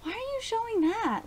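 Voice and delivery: high, puzzled huh of disbelief; confused viewer asking why, pitched well up; baffled. Retold or imitated. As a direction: act out being thrown by the scene. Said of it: "and I was just like".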